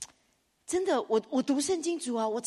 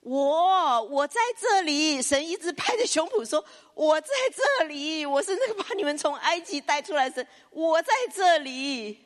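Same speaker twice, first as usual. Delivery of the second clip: louder, higher insistent cry, the end slowed; God pounding his chest, insisting; emphatic proclamation; 7 semitones higher, 6 dB louder than usual. Retold or imitated. God declaring to Israel he is here, loud.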